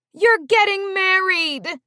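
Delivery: high, loud, slow joyful cry; joyous cry. Acted. Mom jumping to wedding news, joyous cry. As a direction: cry it out with delight. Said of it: "Mom cried out joyously".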